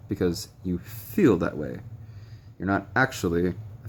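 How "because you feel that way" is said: slow, leaning on the word feel; stress on feel. Shown as a demonstration. To viewers with depersonalization, slowly stressing "feel". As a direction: lean into "feel" and take your time. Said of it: "I really wanna emphasize the word feel".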